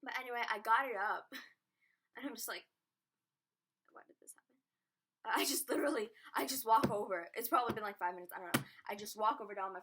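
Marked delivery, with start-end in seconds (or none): 3.9-4.6 whispered bewildered question. Retold or imitated. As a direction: hush it as a stunned thought. Retold bewildered thought.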